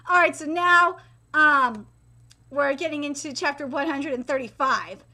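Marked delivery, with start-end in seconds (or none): none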